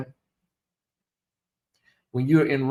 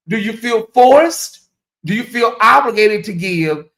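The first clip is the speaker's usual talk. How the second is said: mock dramatic question; 5 semitones higher, 4 dB louder than usual. Teasing viewers about donating.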